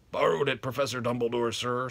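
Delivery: loud gruff giant; booming and hearty; Hagrid voice. Acted.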